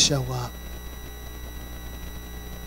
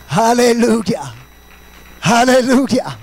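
loud, raised revival cadence; full-force preaching; 7 semitones higher, 7 dB louder than usual. Acted. Preacher praising Mary as a good mother, full-force preaching.

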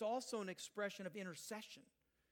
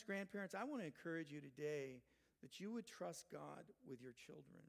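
ordinary delivery

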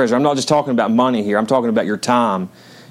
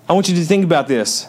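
usual speaking voice

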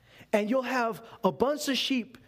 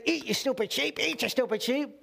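lightly voiced, higher-pitched scolding shepherd; mock impatient shepherd; 4 semitones higher than usual. Pastor mocking a shepherd forcing sheep to eat, light scolding voice. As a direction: play a pushy shepherd shoving grass at sheep. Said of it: "and say".

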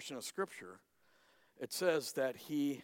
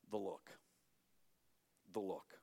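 hushed emphasis; 7 semitones lower, 10 dB quieter than usual. A narrator's reading. Hushed dramatic repetition.

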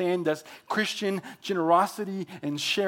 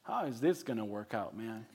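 doubtful Abram; 9 semitones lower, 11 dB quieter than usual. Retold or imitated. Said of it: "and he goes".

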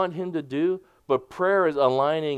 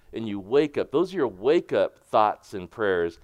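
ordinary talk

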